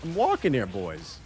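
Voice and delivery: put-on a light New York Italian accent, I'm walking here; Italian New Yorker. Streamer telling viewers about the molten ground, in a light New York accent. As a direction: quote the classic New York street yell lightly. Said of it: "turns me into a completely fucking Italian New Yorker".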